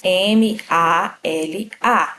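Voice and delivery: slow, letter by letter spelling; spelled letter by letter. Shown as a demonstration. Teacher spelling the word for students, slow and deliberate. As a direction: spell each letter out slowly. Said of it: "A palavra mala tem quantas letras?".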